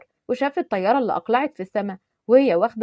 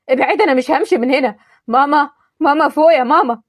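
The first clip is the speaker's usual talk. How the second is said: louder, desperate wailing for mama; anguished crying out; 4 semitones higher, 7 dB louder than usual. Acted. Grieving over the mother, loud anguished calling. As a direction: cry out to wake her.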